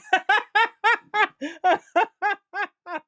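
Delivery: big high burst of laughter; loud laugh. Real, not acted. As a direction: crack up laughing. Laughs after the mimic.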